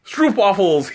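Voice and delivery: high, laughing outburst of the word; giggly shout. Pretend. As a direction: blurt it out with a giggle. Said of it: "Hee, hee, hee".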